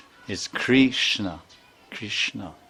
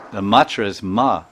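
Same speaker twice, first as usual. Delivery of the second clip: ordinary talk, nothing marked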